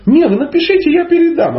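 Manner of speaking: put-on high squeaky little girl voice; girl's voice. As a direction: pitch right up for the naive girl. Teacher voices the girl's reply.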